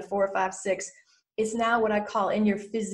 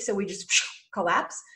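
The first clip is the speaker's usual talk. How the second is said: ordinary talk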